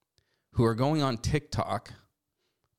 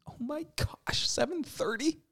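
"seven thirty?" in a much higher, mocking incredulous pitch; mock disbelief; 4 dB quieter than usual. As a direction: squeal it back at her like it's absurd. Mocking the TikToker's complaint.

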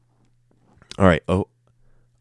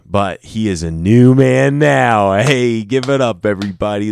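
hype announcement; 2 semitones higher, 6 dB louder than usual. Real, not acted. Hyping up a new friend.

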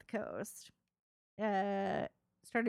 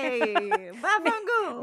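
loud, higher bad mafia Italian; mock Italian accent; 5 semitones higher, 7 dB louder, slower than usual. Showing the cohost the Italian, hammed-up mafia accent. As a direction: ham up an Italian mobster accent. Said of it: "You gotta do the Italian".

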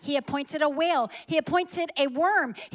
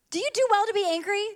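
pitched up and quick, pressing the question; higher, faster repeat of God's question; 7 semitones higher, 3 dB louder, much faster than usual. Shown as a demonstration. Preacher repeating God's question, pitched up and quick. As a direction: ask it again high and fast, pushing back.

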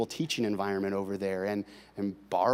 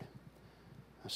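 whisper; 22 dB quieter than usual. Real, not acted. Confessing quietly to congregation.

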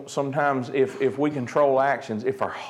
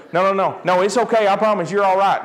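mock-panicky hushing, pitched up; panicked reassurance; 6 semitones higher, 4 dB louder than usual. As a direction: play a kid hurriedly calming a hurt brother. Panicked sibling soothing brother, retold.

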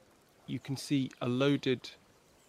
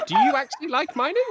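much higher, louder, fast disbelief; incredulous; 9 dB louder, faster than usual. Real, not acted. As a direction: ask it completely disbelieving. GM asks in disbelief.

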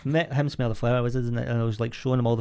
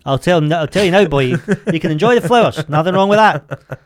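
loud, higher indignant dad rant; hammed-up scolding, voice raised; mock outrage; 3 semitones higher, 6 dB louder than usual. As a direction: lay down the law as a working-class dad. Acted dad scolding his posh son.

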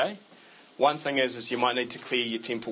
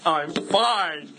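mock huffy, FINE hit hard; fine in wrong tone; 3 semitones higher, 4 dB louder than usual. Preacher mimicking a partner who insists all is fine, wrong tone. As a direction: insist you're fine in a tone that says otherwise. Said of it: "it's been in the wrong tone".

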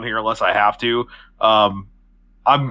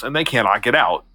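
ordinary talk, nothing marked

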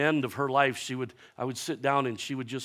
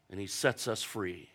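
ordinary delivery

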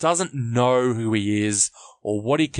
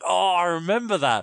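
higher-pitched acted delight; nostalgic delight; 6 semitones higher than usual. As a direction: lightly act out a thrill of recognition. Acts own nostalgic reaction.

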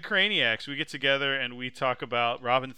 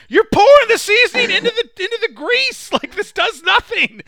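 much higher, loud squealing outrage; outraged critic; 14 dB louder than usual. Retold or imitated. TikTok commenters tearing apart a recipe, high shrieking outrage. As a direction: shriek at the cook in disbelief, laughing. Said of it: "And they're like".

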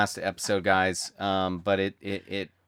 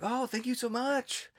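high-pitched, slow mock accent of the actor; Eugenio Derbez impression; 5 dB quieter, slower than usual. Retelling meeting the actor in the street, high imitated accent. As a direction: imitate the actor's accent, much higher and softer. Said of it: "it was this, his accent".

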